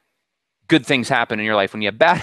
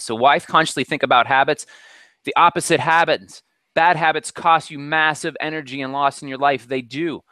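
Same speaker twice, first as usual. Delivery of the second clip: ordinary talk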